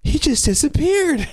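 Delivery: put-on very high, slow child voice; amazed little nephew. Little nephew reports the vanishing to the family, amazed child voice. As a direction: imitate an awestruck small boy, drawn out high. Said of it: "one of the nephews was like".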